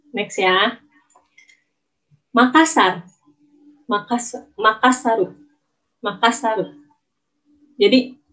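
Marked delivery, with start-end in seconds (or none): 4.6-6.7 "Makassaru" with an added final u; Japanese Makassaru. Shown as a demonstration. Teacher showing students the Japanese form "Makassaru". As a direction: end "Makassaru" on a clear extra u. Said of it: "mati di belakang ditambahin U".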